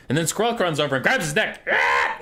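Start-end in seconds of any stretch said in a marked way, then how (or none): 1.7-2.2 loud rough yell; sudden burst of a yell; strangling yell. Acted. Skrulk runs over and grabs his neck, loud yell.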